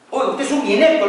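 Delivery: high loud scolding; imitating the architect calling him useless; mock furious yell, high and loud; angry insult. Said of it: "le dijo".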